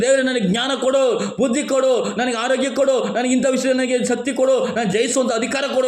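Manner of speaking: high-pitched, repeated petition; insistent prayer. Acted. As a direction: plead with God, item after item. Acts out a believer petitioning God. (ಆಗ್ರಹದ ಪ್ರಾರ್ಥನೆ)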